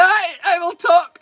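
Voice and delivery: high, slow, emphatic declaration; insistent shout. Real, not acted. Streamer insisting to chat, loud and slow. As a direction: stretch each word out defiantly.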